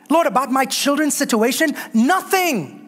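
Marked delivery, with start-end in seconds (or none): 1.9-2.8 NOTHING said high with a falling finish; emphatic nothing. Acted. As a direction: land the word nothing high and final. Dismissing each worry in prayer.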